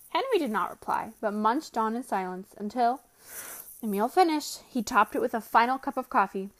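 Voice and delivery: ordinary delivery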